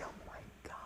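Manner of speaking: barely audible whispered oh-my-God; whispered dismay. Retold or imitated. Lost teacher with kids behind her, whispered dismay.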